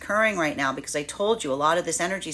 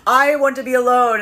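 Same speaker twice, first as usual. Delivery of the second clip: mock dramatic vow; 5 semitones higher, 7 dB louder than usual. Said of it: "talking to each other and being like".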